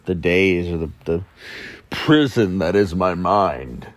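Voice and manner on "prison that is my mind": slow, overwrought and gloomy; mock dramatic. Podcaster mocking his own woes, overdramatic. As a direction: ham up the misery. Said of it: "to be dramatic about it".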